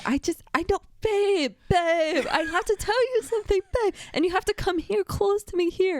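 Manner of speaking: high, fast pleading "babe"; mock urgent pleading. Partner calls her back to bed, hurried mock pleading.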